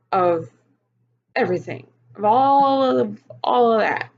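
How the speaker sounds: exasperated. Real, not acted. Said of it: "really tired of stressing".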